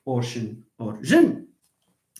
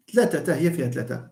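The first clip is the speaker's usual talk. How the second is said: usual speaking voice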